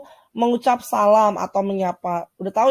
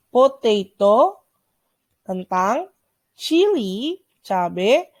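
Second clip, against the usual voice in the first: slow vocabulary model; much slower than usual. Shown as a demonstration. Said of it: "ini berikut ada bahasa Inggrisnya".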